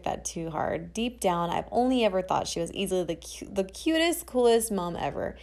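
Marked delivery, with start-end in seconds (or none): none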